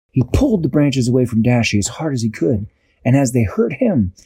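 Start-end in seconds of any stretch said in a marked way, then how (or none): none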